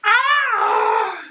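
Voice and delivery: high, loud squeal of joy; excited squeal. Real, not acted. Thrilled by the package, high joyful squeal.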